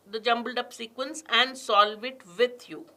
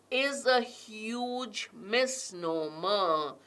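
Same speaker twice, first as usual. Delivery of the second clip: very slow and a touch softer, falling on "misnomer"; slow emphatic read; 6 dB quieter, much slower than usual. Shown as a demonstration. Teacher reading the key phrase to students, slow and falling. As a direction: drag out "is a huge misnomer". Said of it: "I'm also going to stress on certain words".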